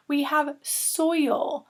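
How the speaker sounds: slow, drawn-out model word for the class. Shown as a demonstration. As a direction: stretch soil out so children hear the oi. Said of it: "So soil has that O I in the middle".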